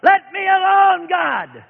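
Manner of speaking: high, loud desperate shout, drawn out slowly; retold scream. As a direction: yell it out, begging God to stop. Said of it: "I finally screamed out loud".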